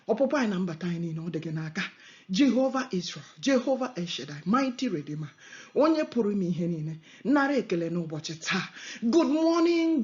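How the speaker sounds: ordinary talk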